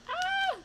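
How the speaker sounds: high, loud yelp; real squeal of alarm; startled shriek. Real, not acted. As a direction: let out a sudden high cry. Startled during play.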